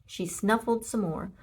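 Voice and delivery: usual speaking voice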